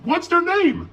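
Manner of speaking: put-on high, loud girl's question. A narrator's reading. Lizzie asking who is inside, high urgent.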